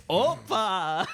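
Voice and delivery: loud, festive exclamation; hammed-up celebratory shout; mock Greek cheer. Pitching a fake Greek hummus ad, mock festive cheer.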